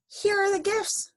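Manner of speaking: very high-pitched presenting voice; excited announcement. A narrator's reading. Amelia Bedelia dumps gifts on Miss Alma's lap, high excited announcement.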